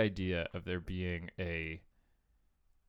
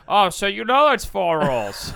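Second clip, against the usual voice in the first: high, loud, slow gotcha voice; dumb cop voice; 10 semitones higher, 12 dB louder, slower than usual. Pretend. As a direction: catch him out, dimly triumphant. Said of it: "And then the guy's like".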